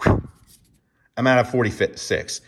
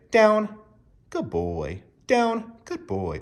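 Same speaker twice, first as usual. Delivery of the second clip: higher, slow and drawn-out praise; sing-song dog praise; 11 semitones higher, much slower than usual. Shown as a demonstration. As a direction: croon it to a dog that got it right. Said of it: "we even praise in a certain way".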